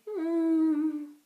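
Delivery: soft groggy moan. A narrator's reading. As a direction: moan as if waking. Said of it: "moaned softly".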